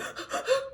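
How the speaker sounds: high, mock out-of-breath gasping; winded gasp. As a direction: gasp as if running up stairs. Acting out hyperventilating on stairs.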